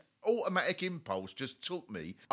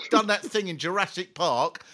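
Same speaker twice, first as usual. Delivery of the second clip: usual speaking voice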